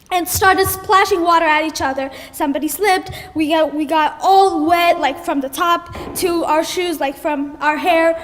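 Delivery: ordinary delivery, nothing marked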